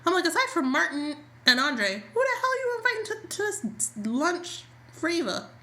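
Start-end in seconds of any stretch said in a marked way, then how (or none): 2.1-5.5 "who the hell" shot up high and fast, then slowed; incredulous, demanding who else is invited; exasperated disbelief. Real, not acted. Exasperated at Ted's guest list.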